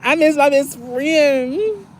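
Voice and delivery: high, slow, whiny wail of missing a friend; high whiny longing. Retold or imitated. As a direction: whimper I miss my best friend like a pouting child. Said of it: "I be like".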